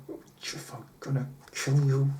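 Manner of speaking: whispered through the pain, a murderous aside; hissed threat. Retold or imitated. Charlie, bitten, whispers a threat at Jeff. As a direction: breathe it so the Koreans can't hear.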